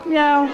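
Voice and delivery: high mewing meow; playful cat sound for the kids. Shown as a demonstration. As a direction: mew it like a kitten. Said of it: "What sound does cats make?".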